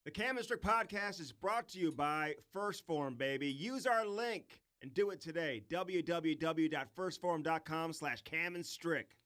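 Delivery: slow, soft sponsor read. Acted.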